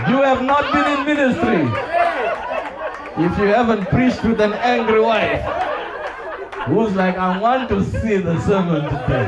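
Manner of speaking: high, loud laughing confession; loud amused aside from the pulpit. Real, not acted. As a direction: laugh it out as you tell it. Laughing story of angry wife.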